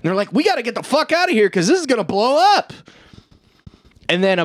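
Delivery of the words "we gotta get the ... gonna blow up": urgent rush. Retold or imitated. Crew urging escape.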